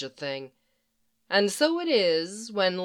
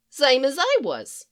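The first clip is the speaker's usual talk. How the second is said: agreeing Harley; 6 semitones higher, 4 dB louder than usual. Acted. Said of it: "Harley agrees".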